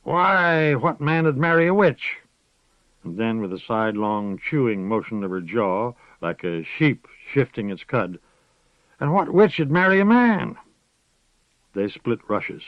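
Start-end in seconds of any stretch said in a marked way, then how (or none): 0.0-2.2 higher, louder crone voice; plain old witch answer; old witch answering simply. A narrator's reading. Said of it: "Moss said simply". 9.0-10.6 high, loud crone voice; old witch turning the question. A narrator's reading. Moss chewing like a sheep.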